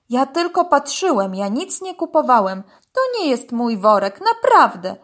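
loud, mildly alarmed denial; frightened protest. A narrator's reading. Dog denies buying to police, scared. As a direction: plead innocence urgently. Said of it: "krzyczał przestraszony".

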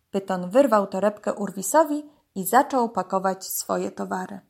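ordinary delivery, nothing marked

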